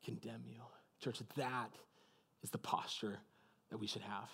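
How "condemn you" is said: soft, low tender pardon; loving and patient. A narrator's reading. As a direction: say it with love in your eyes. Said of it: "with patience, with joy, with love".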